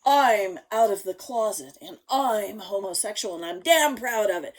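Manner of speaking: loud, emphatic mock announcement; proud declaration. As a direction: imitate someone proclaiming it with pride. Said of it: "It's the outfit of".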